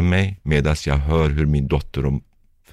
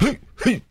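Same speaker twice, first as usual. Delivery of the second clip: short, high-pitched involuntary yelp, imitated; imitated flashback noise. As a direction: mimic a tiny, very high sound twice. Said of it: "jag får ett ljud också". (härmat högt, kort ljud)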